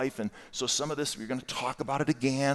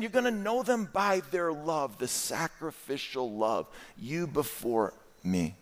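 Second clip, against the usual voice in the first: ordinary talk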